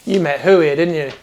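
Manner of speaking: knowing dwarf. Acted. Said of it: "he looks back at you and goes".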